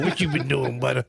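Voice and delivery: put-on lightly raspy old lady voice. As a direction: tease him like a croaky granny. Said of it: "get that raspy old lady voice on".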